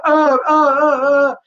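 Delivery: high, loud imitated wailing; mother's distressed cries. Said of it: "her, it was going".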